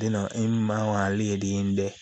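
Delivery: normal talking voice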